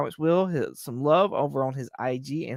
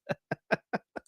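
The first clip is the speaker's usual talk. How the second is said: soft laugh; 7 dB quieter than usual. Real, not acted. Laughing at chat comment.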